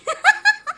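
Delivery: high laugh. Real, not acted. Guest laughs at her answer.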